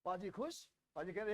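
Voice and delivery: ordinary talk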